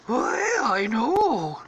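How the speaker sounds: high cartoon dog voice; reply as a yowling cartoon dog; high-pitched mock doggy dismay; Scooby. Scooby answers Shaggy, acted.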